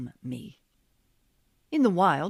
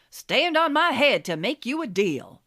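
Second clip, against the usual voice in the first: high, loud Oklahoma huckster twang; a used car salesman pitching in Oklahoma twang; 7 semitones higher, 6 dB louder than usual. Retold or imitated. Worthington promising viewers a deal in Oklahoma twang. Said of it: "in his Oklahoma twang".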